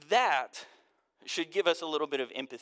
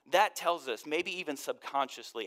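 ordinary delivery

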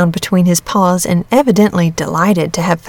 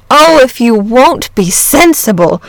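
slow, emphatic, higher-pitched exasperation; exasperated cry; 5 semitones higher, 5 dB louder, slower than usual. A narrator's reading. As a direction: cry out, at the end of patience. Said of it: "cried the boy".

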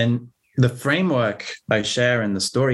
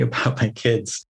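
usual speaking voice

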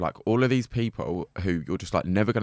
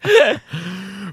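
high, loud burst of laughter; 12 semitones higher, 9 dB louder than usual. Real, not acted. Storyteller laughing along with her friend, loud laugh. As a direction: let out a big laugh.

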